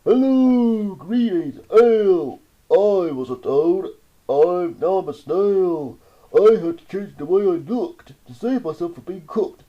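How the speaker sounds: a grand pompous snail; snail speaking. A narrator's reading. Snail greets the child after changing shape, loud. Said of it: "The snail said".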